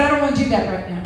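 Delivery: quick refusal. Retold or imitated. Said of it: "I said".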